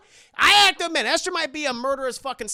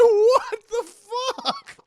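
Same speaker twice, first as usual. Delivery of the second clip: loud, high mock-crying disbelief; imitated stunned wail; 9 dB louder, slower than usual. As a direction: wail it in hammed-up shock.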